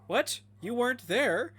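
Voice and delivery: mock surprise at absentees. Mock address to absent viewers.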